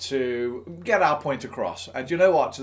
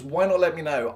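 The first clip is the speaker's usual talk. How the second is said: normal talking voice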